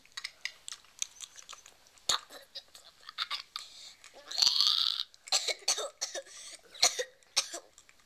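loud, hammy fake cough fit. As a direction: cough it up theatrically. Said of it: "Let's pretend coughs".

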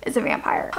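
ordinary delivery

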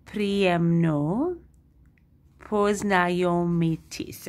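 slow syllable by syllable "Priemno poznayomitis"; sounding out "poznayomitis" very slowly; learner's first try. Shown as a demonstration. Learner sounding out the phrase slowly. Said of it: "it's gonna say something like".

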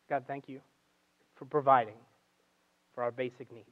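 soft, low, halting thanks to God; quiet prayer. Retold or imitated. Preacher voices Jesus giving thanks before the meal, quiet prayer. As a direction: pray it gently, pausing between phrases.

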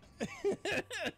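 loud high burst of laughter. Real, not acted. Laughing at own slip.

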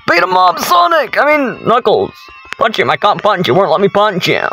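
high, excited shouting easing slightly into complaint; frantic urging. Real, not acted. Player telling Knuckles to punch Sonic, frantic urging. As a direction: egg the fighter on in a rush.

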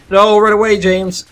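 high, loud cry to flee; alarmed high-pitched yell; panicked shout. Real, not acted. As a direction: yell it in sudden game panic. Flees enemy with crossbow.

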